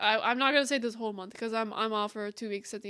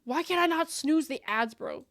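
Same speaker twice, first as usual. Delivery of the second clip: normal talking voice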